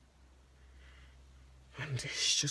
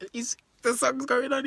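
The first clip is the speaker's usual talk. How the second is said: ordinary talk, nothing marked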